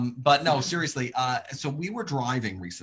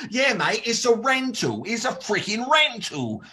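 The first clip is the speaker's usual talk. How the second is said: high, slow gleeful Aussie drawl on "freaking rental"; mock Aussie bloke; 8 semitones higher, slower than usual. Aussie stranger gloating it's a rental, slow gleeful Australian. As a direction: savour it like a carefree Australian.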